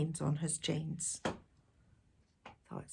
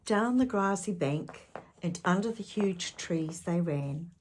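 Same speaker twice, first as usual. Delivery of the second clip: plain reading voice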